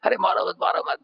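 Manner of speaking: high-pitched acted cry for mercy; hammed-up, high pleading; mock wailing plea. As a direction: imitate a beaten man crying out. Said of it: "रोने लगा, वो चिल्लाने लगा".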